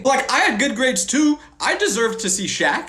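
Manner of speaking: high-pitched, whiny complaint; indignant protest. Acted. Indignant at missing Shaq visit.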